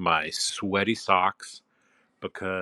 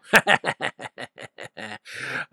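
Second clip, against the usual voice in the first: higher-pitched laugh, falling away; a run of chuckles that falls in pitch; 5 semitones higher, 4 dB louder than usual. Real, not acted. Dominant speaker amused at jocks laughing at you, laughing. Laughs at imagined onlookers mocking listener.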